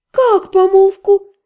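high, slow astonished question; stunned, stretched-out disbelief. A narrator's reading. Ари не верит своим ушам, изумлённо. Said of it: "изумлённо переспросила Ари".

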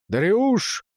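high-pitched call of a name. A narrator's reading. Father calls his son to the window, calling out. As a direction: summon your son from another room.

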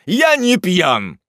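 slow, high, loud denial; wailing loud protest; desperate shout. Acted. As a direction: protest at full voice, drawn out. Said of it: "закричал бедный юноша".

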